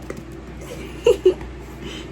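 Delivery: high breathy disbelieving laugh; shocked laugh. Real, not acted. Reader laughing at a surprising scene, high breathless laugh. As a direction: laugh out in stunned delight. Reacting to the book.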